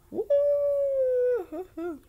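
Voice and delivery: high, slow hooting woo-hoo-hoo; hooting at the villain; mock hoot. Real, not acted. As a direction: let out a long high hoot. Sound after naming worst character.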